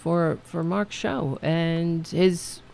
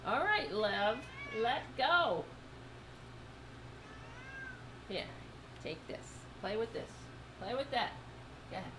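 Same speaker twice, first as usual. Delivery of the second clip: much softer, half-laughing pet talk; soft coaxing; 4 semitones higher, 15 dB quieter than usual. Real, not acted. Host coaxing her cat to let go, soft and laughing. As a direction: coax the cat gently with a toy.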